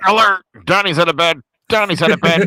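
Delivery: loud, high repeated warning; mock emergency announcement; pretend Alexa alarm. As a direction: blare it like an automated alarm. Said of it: "program Alexa to be like".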